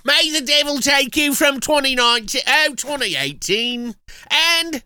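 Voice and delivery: high-pitched mock British; British accent. Host announcing the film titles, British accent. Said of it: "With your British accent".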